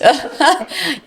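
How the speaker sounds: short real laugh. Real, not acted. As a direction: laugh at the host's joke. Joke about saving marriages.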